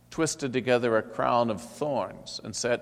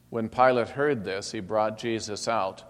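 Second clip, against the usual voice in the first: usual speaking voice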